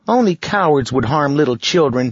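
put-on high, loud girl's voice; a bold little girl speaking up. Girl character voice.

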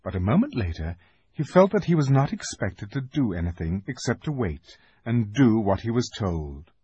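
plain reading voice

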